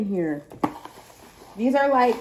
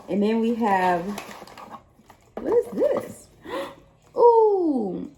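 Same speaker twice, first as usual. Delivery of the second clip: surprised squeal; slower than usual. Real, not acted. Opening a surprise gift.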